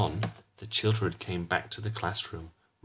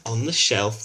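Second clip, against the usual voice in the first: ordinary delivery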